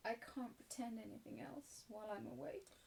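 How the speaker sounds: soft, subdued resignation; weary sadness. Acted. Sarah alone at bedtime, weary sadness. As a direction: give up quietly. Exhausted, sad girl gives up pretending.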